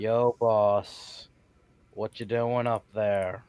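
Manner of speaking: slow, drawn-out hail; unhurried, stretched-out delivery at normal volume; magical message. Acted. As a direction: send the words out slowly. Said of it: "cast message to talk to him".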